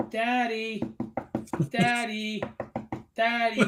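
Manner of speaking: Sheldon. Acted. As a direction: pester Dad through the bathroom door. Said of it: "kinda like Sheldon from The Big Bang Theory".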